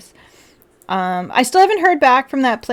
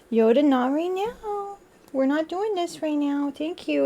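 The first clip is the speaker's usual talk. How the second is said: higher, slower gentle scold to a pet; 6 semitones higher, 5 dB quieter than usual. Real, not acted. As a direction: tell the cat off softly. Talking to her pet Yoda.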